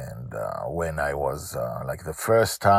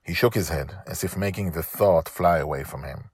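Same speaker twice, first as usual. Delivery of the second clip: plain reading voice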